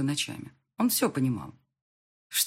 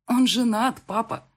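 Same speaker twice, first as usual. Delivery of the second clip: higher, louder cross objection; frowning objection; 7 semitones higher, 6 dB louder, slower than usual. A narrator's reading. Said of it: "нахмурилась я". (нахмурившись, с досадой)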